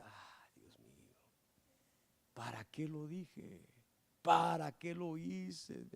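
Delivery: hushed, slow whisper of regret; regretful self-talk. Retold or imitated. Preacher retelling regret after snapping, whispered and slow. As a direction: whisper it to yourself, ashamed. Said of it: "me decía yo".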